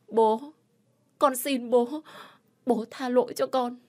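high-pitched plea for forgiveness; tearful pleading. A narrator's reading. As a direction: beg father desperately, voice pitched high. Daughter begs angry father again. (giọng con gái nức nở van xin)

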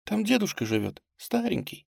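high, soft tearful voice; struggling to speak. A narrator's reading. Girl speaking of her grandfather, with difficulty. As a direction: get it out weakly through tears. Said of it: "с трудом ответила она".

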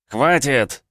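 loud sharp bark. A narrator's reading. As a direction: bark the order. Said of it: "рявкнул я".

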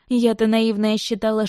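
ordinary delivery, nothing marked

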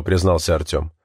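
usual speaking voice

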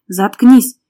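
loud angry bark; sudden loud snap; barked order. A narrator's reading. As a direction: shut them up with one burst. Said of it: "рявкнул Максим".

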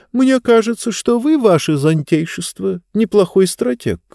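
put-on high, sad old book voice; papery rustling book whisper. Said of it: "печальный голос книги".

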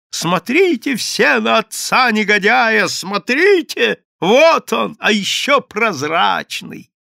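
loud, high, slow public accusation; crying out. A narrator's reading. Madame accusing the transparent father before a crowd, crying out. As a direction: wail it to the street, drawing out each phrase. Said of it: "крича".

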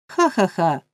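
short gloating ha-ha-ha; sly old man's chuckle. A narrator's reading. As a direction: chuckle to yourself over the boy's trouble. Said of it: "посміхнувся він".

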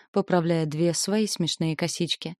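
ordinary delivery, nothing marked

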